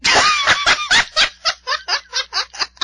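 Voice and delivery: a burst of high, loud laughter. Real, not acted. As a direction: crack up loudly at the letter. Laughs at the letter's tone.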